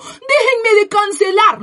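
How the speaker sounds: high, slow, fake-weepy whine; mock her sobbing plea; hammed-up teary voice mocking her; mock crying. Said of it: "sentada llorando diciendo".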